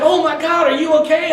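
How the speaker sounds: high, loud alarmed concern; alarmed girl. Retold or imitated. Girl running up to the fallen speaker: high, alarmed. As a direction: rush in worried. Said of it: "She's like".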